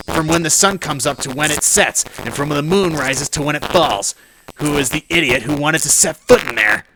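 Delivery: loud, pompous indignation; indignant tirade. Acted. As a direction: lecture them like an outraged mob boss. Congressman rants about the sacred shrine.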